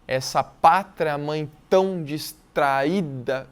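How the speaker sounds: very slow, popping P in pátria and T in tão; stressed P and T. Shown as a demonstration. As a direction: spit out each P and T slowly. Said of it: "eu tô forçando aqui". (bem devagar, estourando o P e o T)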